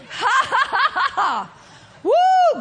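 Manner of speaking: high forced laugh ending in whoop; demonstrated laugh. Shown as a demonstration. Preacher models laughter as medicine, demonstrated laugh. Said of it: "Faking a laugh like I just did".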